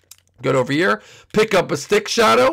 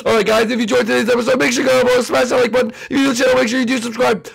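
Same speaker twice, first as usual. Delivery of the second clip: high, loud, rapid-fire sign-off; fast, frantic YouTuber outro; rushed outro; 12 semitones higher, 6 dB louder, much faster than usual. Acted. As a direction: race through the subscribe plea in a panic. Rushed sign-off while fleeing.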